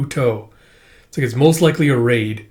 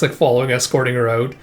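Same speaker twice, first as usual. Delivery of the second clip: usual speaking voice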